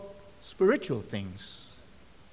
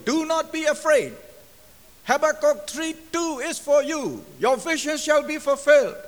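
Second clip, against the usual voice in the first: loud, high, ringing prophetic declaration; clear, distinct proclamation; 8 semitones higher, 9 dB louder than usual. Retold or imitated. Woman in church prophesying to a visiting preacher, clear loud voice. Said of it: "in a clear, distinct voice".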